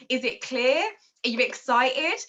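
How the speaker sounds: ordinary delivery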